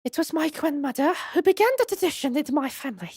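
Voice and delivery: put-on high, quick French accent; Esmeralda Tuspels. Madame recounts her grandmother's tradition, high French accent. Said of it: "they don't write the accents down".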